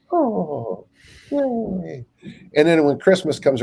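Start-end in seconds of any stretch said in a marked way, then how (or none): 0.1-2.1 higher, lightly put-on cheer; mock glee. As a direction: fake a little cheer about the sale. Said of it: "you might be like".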